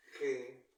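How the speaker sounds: "ge" said loud and strong; stressed syllable. Shown as a demonstration. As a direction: hit "ge" hard. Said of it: "el acento prosódico se encuentra en la segunda". ("ge" con fuerza, sílaba tónica)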